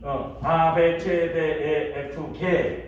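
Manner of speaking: 아베, 체데, 에, 에프, 게 spelled out slowly letter by letter in German; slow German letter names, 아베 체데 에 에프 게; German alphabet. Shown as a demonstration. Pastor teaching the class German letter names, spelled out slowly. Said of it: "이렇게 읽어요".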